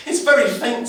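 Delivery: high, loud intern voice. A narrator's reading. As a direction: pipe up like an anxious junior. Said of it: "said the intern".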